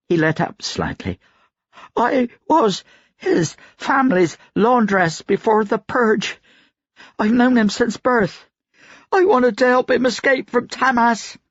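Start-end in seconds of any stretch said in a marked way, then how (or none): 2.0-11.4 very high, strained, terrified voice; frightened confession. A narrator's reading. Laundress confesses as the grip lets up, strained and frightened. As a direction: plead high through a strained throat.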